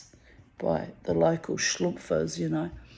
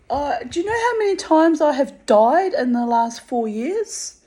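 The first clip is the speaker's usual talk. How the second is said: much higher and louder, incredulous; retold indignant disbelief; 8 semitones higher, 6 dB louder than usual. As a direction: scoff at the idea that covid could kill her. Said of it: "And they'd be like".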